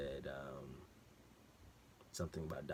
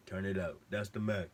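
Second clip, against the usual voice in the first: higher and louder, punching the word max; hammed-up meme voice; 3 semitones higher, 5 dB louder than usual. Narrator to viewers, a loud hammed-up meme quote. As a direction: belt "Turn it up. That's the max." as a goofy meme bit, with a fall on max. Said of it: "make a little jokey joke".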